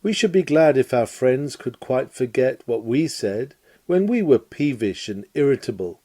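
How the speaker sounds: ordinary talk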